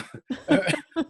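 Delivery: high, self-mocking laugh; amused laugh. Real, not acted. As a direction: crack up at your own vanity. Laughs at own ego joke.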